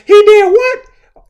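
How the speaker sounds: high, loud, slow shocked cry; a mother's shrill outraged shout of disbelief. Retold or imitated. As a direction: shriek in disbelief like an angry mom. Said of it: "You hear her going off".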